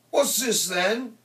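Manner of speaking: high-pitched, loud Scouse challenge; thick Scouse accent. A narrator's reading. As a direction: put on a thick Liverpool accent. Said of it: "said in a thick Scouse accent".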